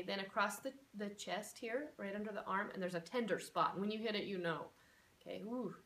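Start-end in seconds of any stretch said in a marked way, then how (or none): none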